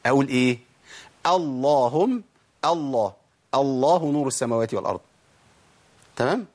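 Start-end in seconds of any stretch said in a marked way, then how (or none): 1.3-5.0 thick, dark l starting "الله" and "اللهم"; heavy lam. Shown as a demonstration. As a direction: open "الله" and "اللهم" with an emphatic l. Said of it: "وتفخم إمتى بقى لما أبدأ بيها".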